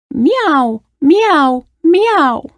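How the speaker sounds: high meows falling at the end; screeching cat. A narrator's reading. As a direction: imitate an upset cat. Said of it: "the cat".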